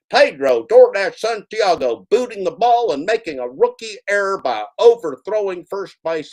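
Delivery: high, loud sportscaster outburst; excited shout. Acted. Commentator calling Pedro's rookie error, loud and high. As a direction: belt it out like a commentator calling a blunder.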